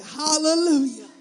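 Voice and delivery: loud, slow, gleeful mock cheer; mock hallelujah. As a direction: play the congregant thrilled to eat. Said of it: "some of y'all are like".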